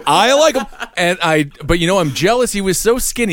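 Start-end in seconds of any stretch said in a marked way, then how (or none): 0.1-0.7 comically high, insistent endorsement; squeaky, pitched-up approval; high-pitched opinion. Pretend. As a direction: repeat it high and emphatic. Repeats his own comic answer.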